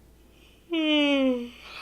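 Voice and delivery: loud yawn. Real, not acted. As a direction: let out a long, loud, real yawn. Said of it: "So very sorry for yawning at you".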